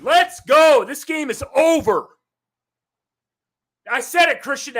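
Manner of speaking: fired up. Real, not acted. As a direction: gloat that the game is already won. Celebrating his picked team scoring.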